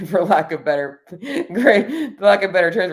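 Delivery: laughing through the words, pitch jumping up on "gray". Real, not acted. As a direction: giggle while hunting for the phrase. Laughs at her own word.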